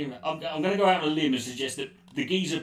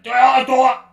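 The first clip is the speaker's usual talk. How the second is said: shrill, much higher, loud yelped heckle; mocking heckle; 12 semitones higher, 16 dB louder than usual. Retelling the heckler in Covent Garden, a loud high mock yell. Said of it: "this guy just sort of went".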